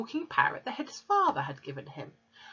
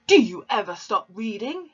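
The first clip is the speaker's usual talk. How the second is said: sharp, loud snap at his daughter; snapped; 4 semitones higher, 8 dB louder than usual. A narrator's reading. Father snapping at his reading daughter, sharp and loud. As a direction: bite the question off. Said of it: "he snapped at her".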